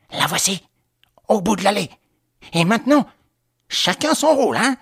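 high, loud call to accomplices; urgent accomplice, pitch climbing; conspirator. Acted.

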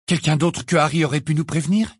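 high, pressing question; grasping for a way out. A narrator's reading. Ginny asks Sirius, high and pressing. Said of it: "dit Ginny".

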